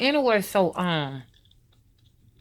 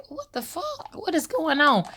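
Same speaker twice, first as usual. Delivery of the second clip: startled; 9 semitones higher than usual. Retold or imitated. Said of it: "and I would say".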